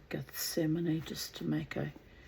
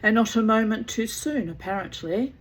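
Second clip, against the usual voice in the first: louder, higher tart retort, lightly acted; grim; 5 semitones higher, 7 dB louder than usual. A narrator's reading. Mother answering her dramatic daughter, grim emphatic retort. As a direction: finish the reproach with grim emphasis. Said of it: "said Mother grimly".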